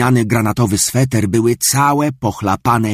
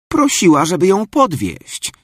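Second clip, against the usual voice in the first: pitched up eager explaining; high child voice; 10 semitones higher than usual. Acted. Girl explains to her mother, pitched high.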